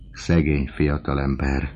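usual speaking voice

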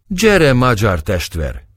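slow, high, hearty beckoning; cheerful invitation. A narrator's reading. Burly spahi inviting the Hungarian youth, cheerful. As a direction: jovially call a brother over, drawing it out. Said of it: "szólt jókedvűen az egyik spáhi".

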